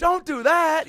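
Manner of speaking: very high, slow, loud fussy tone; mock prim voice. Mocking the crowd's reaction, very high and slow. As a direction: play a shocked prissy onlooker. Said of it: "And you go".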